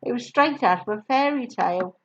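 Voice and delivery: ordinary delivery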